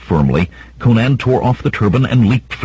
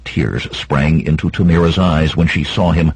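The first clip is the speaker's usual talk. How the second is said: usual speaking voice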